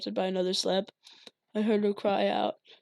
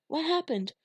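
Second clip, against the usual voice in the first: put-on little girl voice; 8 semitones higher than usual. Little sister high voice.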